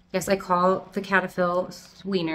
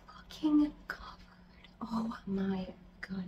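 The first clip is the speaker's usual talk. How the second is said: a real unvoiced whisper, softer, appalled; whispered disgust; 2 semitones lower, 10 dB quieter, slower than usual. Real, not acted. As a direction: hiss it out in disbelief, softly. Disgust at spider mites.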